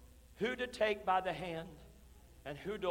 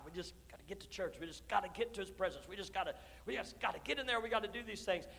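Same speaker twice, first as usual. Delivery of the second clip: rushing mock urgency; 9 dB quieter, faster than usual. Mimicking rushing to church.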